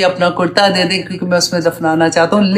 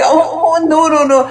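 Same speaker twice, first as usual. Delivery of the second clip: put-on high, panicky "ओ, ओ, नो, नो, नो" like a kid caught red-handed; caught child's panic; 8 semitones higher, much slower than usual. Teacher imitating a child caught by mother, high and panicky. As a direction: plead in a high startled voice, playfully imitated. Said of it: "फक रंग नहीं हो जाता है जैसे".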